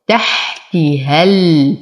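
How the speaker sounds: slow تحت with a breathy, held ha; recitation. Shown as a demonstration. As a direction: linger on the ha of تحت. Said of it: "أصبر على الحاء".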